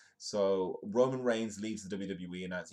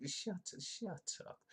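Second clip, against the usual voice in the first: slow, high breathy falsetto; mock disbelief; 11 semitones higher, 10 dB quieter, slower than usual. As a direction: drag it out in squeaky disbelief. Disbelief at friend's news.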